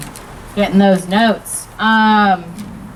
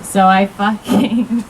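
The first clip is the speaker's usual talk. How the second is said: usual speaking voice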